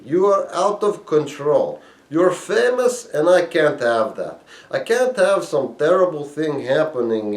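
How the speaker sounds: a high, loud Russian dealer cutting him off; a Russian-accented refusal, pitched well up. Retold or imitated. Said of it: "she said".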